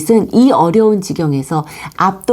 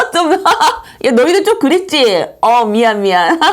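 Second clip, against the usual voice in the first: laughing; 10 semitones higher than usual. Real, not acted. Teacher laughs at own voice.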